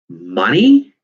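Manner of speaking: loud, rising disbelieving question; incredulous echo. A narrator's reading. Caddy echoing Tom's idea, incredulous. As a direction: repeat the word in disbelief. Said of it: "echoed Caddy incredulously".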